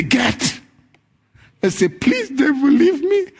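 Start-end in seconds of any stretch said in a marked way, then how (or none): 0.0-0.6 firm command. Shown as a demonstration. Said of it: "He got to say". 2.0-3.3 high, whiny begging, rising to the end; mock pleading. A timid believer begging the devil, high and whiny. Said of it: "he likes you to say that".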